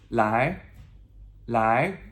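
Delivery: "lai" climbing like "what?"; questioning second tone. Shown as a demonstration. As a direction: swing each "lai" upward. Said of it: "that questionable".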